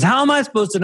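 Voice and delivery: high whiny imitation of him; imitating husband. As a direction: mimic his exasperated whine, high. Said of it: "when he goes".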